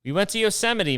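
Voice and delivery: "Yosemite" said high and bright with excitement; excited restart. Shown as a demonstration. Retelling the trip to viewers, restarts it excitedly. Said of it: "I don't know why I said it like that".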